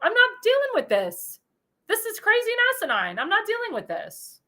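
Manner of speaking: lightly voiced exasperated official brushing it off; mock exasperated official. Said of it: "Thomas Homan's like".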